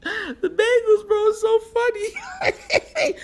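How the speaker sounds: very high, slow, squeaky laugh-crying words; words squeezed out through helpless laughter; laughing hard. Real, not acted. Laughing at the Bengals, high and slow through tears. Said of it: "I can't stop laughing about it".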